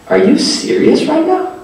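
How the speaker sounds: high-pitched disbelieving question; incredulous. Retold or imitated. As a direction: mimic a friend who can't believe it. Friend reacts in disbelief.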